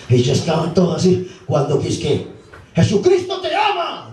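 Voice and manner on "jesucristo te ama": high mock shout of Jesucristo te ama; imitated church woman's high cry; church lady shouting. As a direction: call it out across the street, hammed-up.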